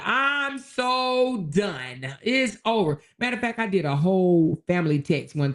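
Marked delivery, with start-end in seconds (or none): 0.0-3.0 drawn-out fed up. Retold or imitated. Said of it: "be like".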